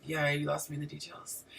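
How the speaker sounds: low, quiet imitation of a blunt friend. Male friend tuning out of her story, blunt. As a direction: toss it off softly and low, mock blunt. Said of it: "He's so blunt. He's like".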